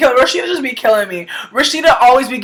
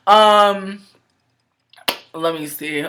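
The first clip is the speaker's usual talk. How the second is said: normal talking voice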